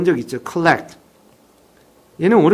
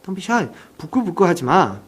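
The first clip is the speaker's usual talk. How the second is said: usual speaking voice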